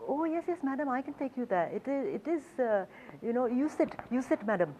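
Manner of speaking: high, eager Indian English of a rickshaw driver; Hindi accent. Retold or imitated. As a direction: play the keen Delhi driver in a Hindi accent. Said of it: "my attempt at a Hindi accent".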